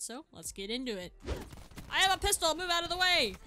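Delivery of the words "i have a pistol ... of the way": loud, high, thrilled shout; bursting out with a new weapon; hyped gamer yell; excited yell. Real, not acted. Excited shout at teammates in game.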